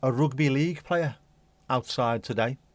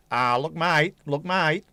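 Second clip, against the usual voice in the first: louder, higher Australian post-match drawl; Australian sportsman; 4 semitones higher, 5 dB louder, slower than usual. Retold or imitated. Mocking an Australian sportsman's interview, Australian accent. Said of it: "they all sound the same".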